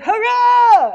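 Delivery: high, loud cheer; gleeful cheer. Retold or imitated. Director greets her arrival, loud high cheer. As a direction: whoop for joy. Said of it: "he's like".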